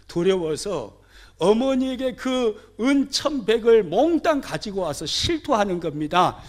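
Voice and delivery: ordinary talk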